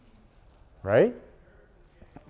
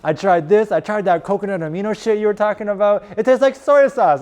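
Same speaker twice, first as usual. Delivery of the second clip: much higher, thrilled client reporting back; excited athlete; 8 semitones higher, 4 dB louder than usual. Retold or imitated. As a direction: gush like a surprised convert. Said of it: "he's like".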